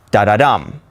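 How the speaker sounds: da-da-dum, two light beats then a strong DUM; anapest stress. Shown as a demonstration. Teacher showing how anapest sounds, da-da-DUM. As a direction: run "da-da" lightly into a weighted "dum". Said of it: "Anapest sounds like".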